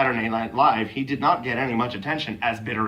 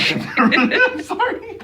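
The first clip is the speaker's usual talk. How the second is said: high, slow, laughing apology; losing composure; 5 dB louder, slower than usual. Real, not acted. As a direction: try and fail to stop laughing. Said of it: "Don't try to compose yourself".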